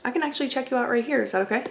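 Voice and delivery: ordinary talk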